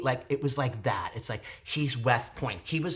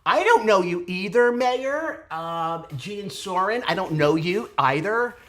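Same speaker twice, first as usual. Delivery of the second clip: high, loud excited call-out; 5 semitones higher, 6 dB louder than usual. Real, not acted. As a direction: greet him with a burst of excitement. Excited address to cast member.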